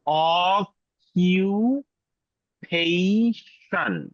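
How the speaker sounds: অকুপেশন dragged out, ok-yu-yu-pei-shun; exaggerated slow model. Shown as a demonstration. Teacher to class, watch my mouth, extremely slow. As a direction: mouth অকুপেশন hugely slowly so they can watch. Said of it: "আমার মুখের দিকে খেয়াল করেন".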